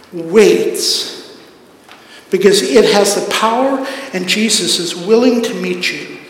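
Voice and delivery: usual speaking voice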